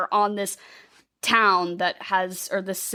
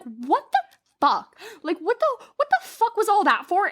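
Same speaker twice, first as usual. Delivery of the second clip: pitched way up, stunned; retold disbelief; 10 semitones higher than usual. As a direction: squeak out the disbelief. Retold shock at reveal.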